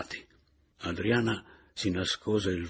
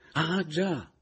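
higher-pitched dawning "oh, right"; sudden realisation; 9 semitones higher, 3 dB louder than usual. Acted. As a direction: let it suddenly click. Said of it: "comprendendo a un tratto". (comprendendo a un tratto)

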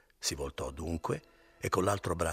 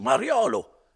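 much higher and louder, railing; angry outburst; 12 semitones higher, 9 dB louder than usual. Acted. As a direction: hurl the accusation at him. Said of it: "esclamò Renzo".

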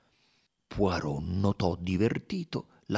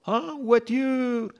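drawn-out high call, slow; shouted call; 6 dB louder, much slower than usual. Acted. Platform guard calling the train to board. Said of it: "una voce gridò".

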